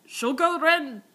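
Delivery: loud call for the wren; cartoon narrator calling sugar wren out loud. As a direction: call the bird over loudly. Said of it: "But then it's like".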